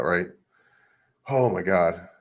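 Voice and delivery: normal talking voice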